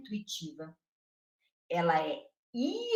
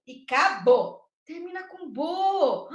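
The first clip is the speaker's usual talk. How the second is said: delighted discovery; 6 semitones higher, 4 dB louder, slower than usual. Acted. Teacher marvels at the rhyme.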